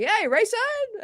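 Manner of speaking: high-pitched imitation of an excited fan; fan calling out. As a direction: call the name way up high like a fan. Voicing fans calling out his name.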